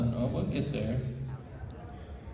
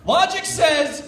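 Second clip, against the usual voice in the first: higher, louder protest; protesting shout; 13 semitones higher, 7 dB louder, much slower than usual. Acted. Doogie protesting Mew's countdown, loud shout. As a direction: cry out in protest.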